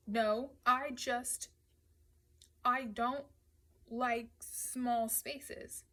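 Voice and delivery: hesitate, then drag out the confession; uneasy admission. A narrator's reading. Rossi admits fear of small spaces.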